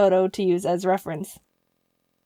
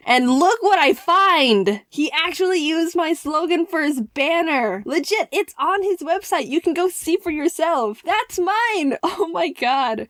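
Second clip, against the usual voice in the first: climbing higher with delight at the find; thrilled discovery; 6 semitones higher, slower than usual. Real, not acted. Vlogger finding her own slogan live on his site, rising excitement.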